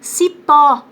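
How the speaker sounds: "Cipó" com PÓ alto e forte; tônica em PÓ. Shown as a demonstration. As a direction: bata na sílaba final de "Cipó". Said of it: "é o pó que é mais forte". ("Cipó" oxítona, Ó aberto e destacado)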